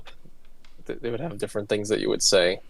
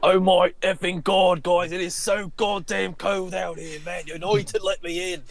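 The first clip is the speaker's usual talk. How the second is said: put-on high, slow drunken English accent; drunk Englishman; 8 semitones higher, slower than usual. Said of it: "so he'd be like".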